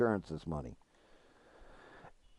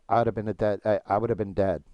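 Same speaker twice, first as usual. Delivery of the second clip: voice cracking. Real, not acted. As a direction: voice cracking with emotion. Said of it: "you probably heard my voice crack there".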